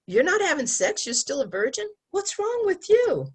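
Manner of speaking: high, mocking taunt; taunting peer. Peer taunting a virgin, high mocking taunt. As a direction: jeer like a teasing classmate.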